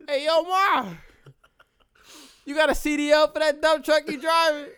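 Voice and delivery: loud, high, hammed-up pickup line called across the room. [name] calling out a corny pickup line to a woman, loud and hammed-up. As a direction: holler it at her like a cheesy flirt. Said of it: "What I say?".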